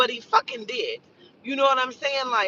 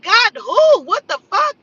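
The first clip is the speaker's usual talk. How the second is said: shocked disbelief; 9 semitones higher, 9 dB louder than usual. Retold or imitated. Retold shocked reaction to the news.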